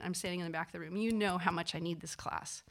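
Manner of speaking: usual speaking voice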